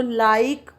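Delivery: "like" said loud and firm; stress on LIKE. Shown as a demonstration. Teacher pointing out the highlighted word, loud LIKE. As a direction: punch the word "like". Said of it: "वहाँ पर like को highlight किया".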